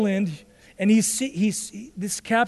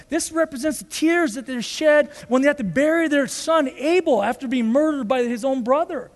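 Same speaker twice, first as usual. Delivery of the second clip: ordinary talk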